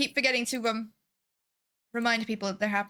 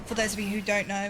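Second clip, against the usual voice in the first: usual speaking voice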